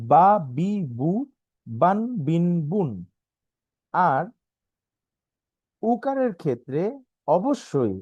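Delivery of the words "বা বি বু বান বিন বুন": বা, বি, বু, বান, বিন, বুন all short, no drawing out; clipped syllables with no stretch; without lengthening. Shown as a demonstration. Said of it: "টান ছাড়া।". (টান ছাড়া উচ্চারণ)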